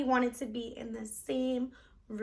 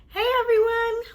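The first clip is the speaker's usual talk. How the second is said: upbeat, louder hello to viewers; bright greeting; 10 semitones higher, 6 dB louder than usual. Real, not acted. Teacher greeting her viewers, bright and upbeat. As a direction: greet everyone with a big smile.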